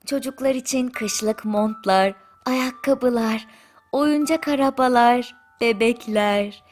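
ordinary delivery

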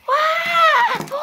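high-pitched startled shout; shocked cry. Acted. Director seeing the frogs fall, shocked high shout. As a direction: yell it in high-pitched disbelief.

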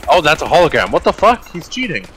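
loud, high disbelieving outburst; surprised outburst. Real, not acted. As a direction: react in shocked disbelief. Shot by hologram trick.

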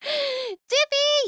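high, loud, drawn-out squeal of a name; excited high-pitched shout; delighted greeting. Real, not acted. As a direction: call out a friend's name with glee. Delighted friend has arrived.

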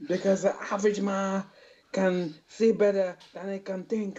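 halting, giggly mock Japanese accent. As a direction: stumble through a hammed-up Japanese accent, breaking into laughter. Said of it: "how do you say average in Japanese".

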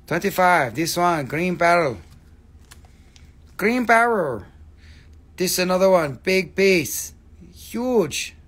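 loud, high broken-English sales calls; mock hawker. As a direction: punch out each short phrase like a street seller. Hawking stones in broken English.

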